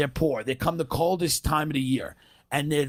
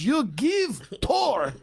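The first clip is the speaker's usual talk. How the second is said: higher, very slow mock Russian demand; slow broken-English Russian accent, pitched up; 7 semitones higher, much slower than usual. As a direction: drag it out like an angry Russian tourist. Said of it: "she goes".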